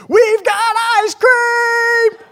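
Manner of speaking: very high, drawn-out joyful holler; loud imitated kid's shout; yelling to kids. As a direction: yell it slowly across the whole street. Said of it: "he yells at all the other kids".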